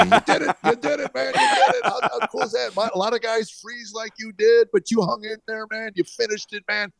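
high-pitched, hyped-up cheering; Terry Crews congratulating. Retold or imitated. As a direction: gush like an overjoyed buddy in a high voice. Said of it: "he's hugging me".

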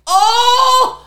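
very high, drawn-out cry of joy; high joyful exclamation; joyous. Retold or imitated. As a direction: imitate a man overwhelmed with relief. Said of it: "most joyous look on his face".

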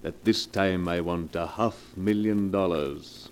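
low, smooth, unhurried menace; oily blackmailer. Acted. Blackmailer coolly naming his price to Mrs Morgan, low silky menace. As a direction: purr the threat, savouring it.

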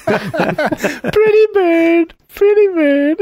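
high, slow squawking parrot. Acted.

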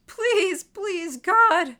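high, desperate, loud begging; panicked begging, bursting loudest at the end; screamed pleading. A narrator's reading. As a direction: scream a frantic plea. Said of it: "Gordon screamed".

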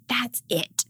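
imitating an upset toddler in a breathy whisper; whispered huff. Toddler mocking parents, imitated in a sulky whisper. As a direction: hiss it like a sulking three-year-old. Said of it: "his new thing, if he's upset, is like".

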